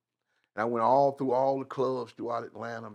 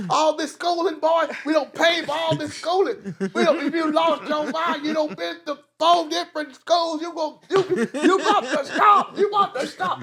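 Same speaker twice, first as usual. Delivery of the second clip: loud, high shouting through laughter; mock scolding parent; 7 dB louder than usual. Parent yells at son for quitting school, laughing mock rant. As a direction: ham up the outraged parent.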